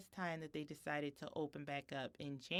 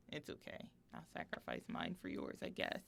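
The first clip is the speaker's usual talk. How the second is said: resigned whisper; 11 semitones lower, 7 dB quieter than usual. Real, not acted. Mother's resigned aside about losing me time.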